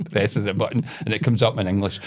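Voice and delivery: ordinary talk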